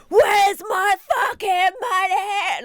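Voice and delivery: high, loud, drawn-out imitation of fury; mock angry demand. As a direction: roar it as a hammed-up angry customer. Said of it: "he's angry".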